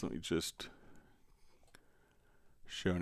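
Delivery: soft, half-voiced aside to oneself; muttered aside. Real, not acted. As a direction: drop right down, as if busy with a task. Muttering to self while setting up example.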